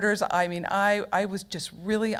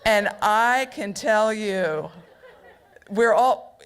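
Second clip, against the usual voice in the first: ordinary delivery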